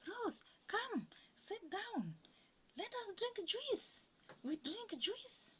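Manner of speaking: high-pitched, drawn-out imitation of friendly coaxing; mock friendly invitation. Acquaintance invites Ruth to sit and drink juice, high mock coaxing. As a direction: call her over in a high put-on voice. Acting out people inviting her over.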